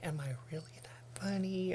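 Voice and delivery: near-whispered self-doubt. Retold or imitated. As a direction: let the voice drop to a hushed, unsure question. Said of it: "I just feel like".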